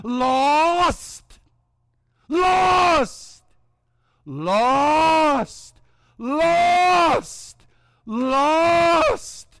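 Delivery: high, loud, drawn-out cries of "Lost!"; the buggy wheels crying "Lost!" at Jim on the dirt road; squeaking wheels crying Lost. Acted. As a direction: wail "Lost!" like wheels on every turn.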